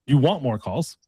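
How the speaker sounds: normal talking voice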